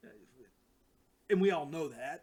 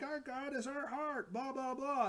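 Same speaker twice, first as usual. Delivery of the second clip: much higher little creature voice; cute creature voice; 9 semitones higher than usual. Retold or imitated. Bunny creatures answering Thanos in a high cute voice. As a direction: squeak it out like a tiny cheerful bunny.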